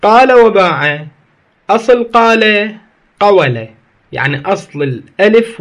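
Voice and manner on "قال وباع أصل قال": قال and باع slow and louder; slow louder stress on قال; slow emphatic demo. Shown as a demonstration. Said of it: "لاحظوا".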